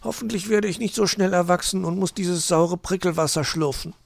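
high little hedgehog voice; mildly glum, high child voice; Borstel. A narrator's reading. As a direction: grumble like a small child, pitched high.

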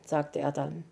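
ordinary delivery, nothing marked

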